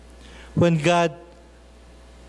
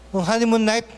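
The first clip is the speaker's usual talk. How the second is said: ordinary talk, nothing marked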